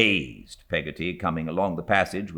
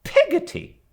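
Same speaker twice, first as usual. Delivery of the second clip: much higher, loud falling indignation; indignant repeat; 8 dB louder than usual. A narrator's reading. Stern aunt repeating the servant's odd name, indignant. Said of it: "repeated Miss Betsy with some indignation".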